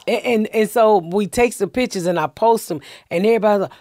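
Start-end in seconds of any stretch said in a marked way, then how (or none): none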